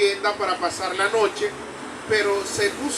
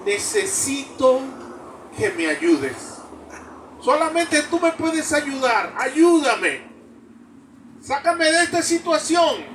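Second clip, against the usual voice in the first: desperate plea; 6 semitones higher than usual. Acted. Climber pleading to God.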